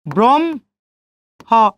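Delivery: ব্রহ্ম as "brom", pause, "ho"; split pronunciation. Shown as a demonstration. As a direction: sound the m before the h in ব্রহ্ম. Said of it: "তাহলে কি উচ্চারণ করবো? ম এবং হ".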